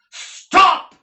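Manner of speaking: a huge high shout that rings the rafters; ringing shout. Acted. Nicholas stops Squeers beating the boy, a rafter-ringing shout. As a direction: bellow it to halt the beating. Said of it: "in a voice that made the rafters ring".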